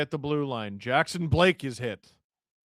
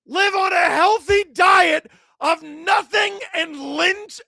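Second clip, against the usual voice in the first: screaming, much higher and louder play-by-play of a tying goal; ecstatic goal call; 9 dB louder, slower than usual. Real, not acted. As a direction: lose your mind as your team ties it.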